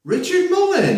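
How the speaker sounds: high, loud disbelief; shocked squeal of the name; surprised. Real, not acted.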